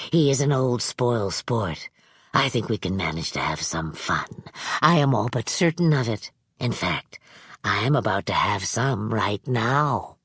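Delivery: put-on sly old man. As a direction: purr it like a kindly villain. Manx voiced.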